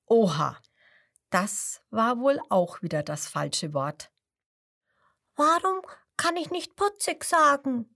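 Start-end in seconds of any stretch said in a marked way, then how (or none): 5.4-7.9 high squeaky puzzled question; baby crocodile's high question; little crocodile asking. A narrator's reading. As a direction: ask it in a tiny wondering voice. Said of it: "fragt das kleine Krokodil".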